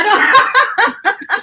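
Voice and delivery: high laughing reply. Real, not acted. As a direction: laugh while saying it. Laughing at secret remark.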